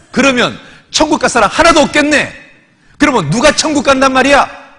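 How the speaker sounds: very loud, high imitation of an indignant deaconess; acted furious objection; angrier outburst. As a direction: demand an answer in outraged protest. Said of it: "이분이 더 화를 내는 거예요".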